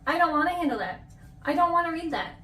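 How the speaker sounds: ordinary delivery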